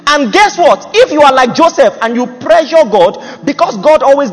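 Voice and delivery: high, loud pulpit exhortation; impassioned preaching. Real, not acted. Preacher explaining purpose to the congregation, impassioned loud preaching. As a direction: drive the warning home to the congregation at full force.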